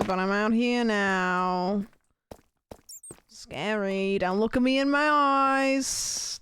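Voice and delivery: nervous Enderman with a hammed-up, off-Australian accent; attempted non-Australian Enderman accent. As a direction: stretch the vowels in a silly Enderman accent. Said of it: "Oh, they're not Australian?".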